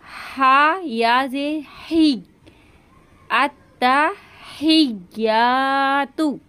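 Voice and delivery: अत्तहियातु, very slow, leaning on the doubled त; doubled t held. Shown as a demonstration. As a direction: stretch अत्तहियातु and hold the त. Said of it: "तशदीद है ता के ऊपर इसको जाहिर करना है". (तशदीद ज़ाहिर करके, धीरे धीरे)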